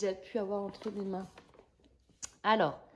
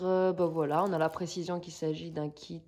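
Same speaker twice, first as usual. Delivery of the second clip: ordinary delivery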